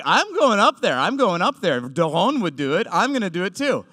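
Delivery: determined. Retold or imitated. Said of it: "but I was like".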